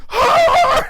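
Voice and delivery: very high mock battle scream; cartoonish high-pitched war cry; charging yell. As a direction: scream as you charge in headfirst. Imitates Ram Man's charging yell.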